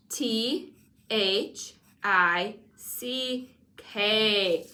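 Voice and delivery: very slow letter by letter; slow spelling out. Shown as a demonstration. Teacher spelling thick slowly with the pupil. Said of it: "spell it".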